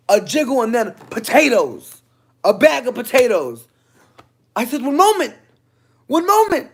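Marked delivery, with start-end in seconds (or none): 4.8-6.7 very high, loud, strained politeness; high polite voice. Retold or imitated. To the coworker banging again, a very high strained polite voice. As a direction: rise sharply into a prim chirp. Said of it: "my Caucasian come out".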